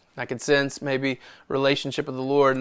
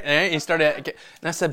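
ordinary talk

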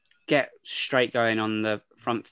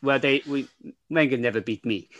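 put-on Mourinho accent. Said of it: "that was terrible".

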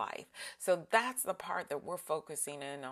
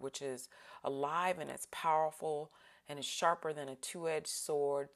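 ordinary talk, nothing marked

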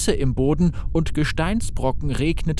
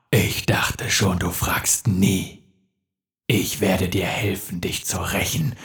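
sinister demon; 6 semitones lower than usual. Acted. Demon offers Gareth revenge for his body, low and menacing. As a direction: bargain coldly for his body.

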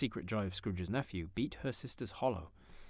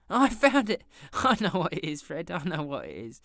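much higher, loud triumphant shout; excited cry; 8 semitones higher, 11 dB louder than usual. A narrator's reading. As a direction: burst out with the answer. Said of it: "cried out".

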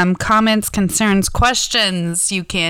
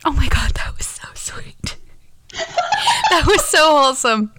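giggly whisper; 7 semitones higher than usual. Real, not acted. Giggling after sweet whisper.

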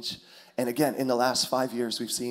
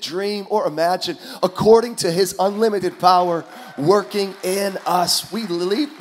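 higher, loud sermon climax; full-force preaching; 6 semitones higher, 6 dB louder than usual. Acted. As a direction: preach it with fire, building.

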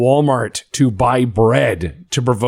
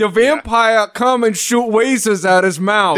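mock villain voice; 10 semitones higher than usual. Mock villain game narration.

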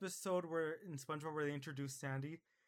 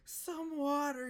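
high, slow, dried-out plea; dying SpongeBob; 10 semitones higher, much slower than usual. Retold or imitated. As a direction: croak it out like he's drying up. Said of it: "He's like".

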